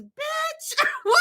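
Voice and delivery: high, incredulous mock outrage; retold thought, high and laughing; inner outrage. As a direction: squeal it in disbelief. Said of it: "all I can think is".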